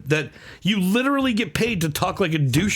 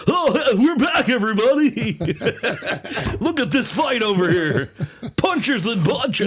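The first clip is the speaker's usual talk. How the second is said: announcer impression; 5 semitones higher than usual. Announcer back on air after the break, hammed-up hype. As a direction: switch on the over-the-top announcer act. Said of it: "oh, we're going back?".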